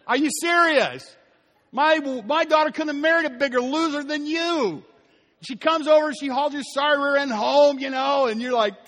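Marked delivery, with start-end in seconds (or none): 0.0-4.8 mother-in-law berating the son-in-law, high and scornful. Retold or imitated. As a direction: sneer at the loser son-in-law.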